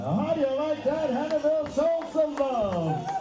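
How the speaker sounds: high, hyped crowd call; powwow emcee. Real, not acted. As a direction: pump up the crowd over the mic.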